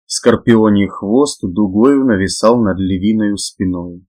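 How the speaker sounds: usual speaking voice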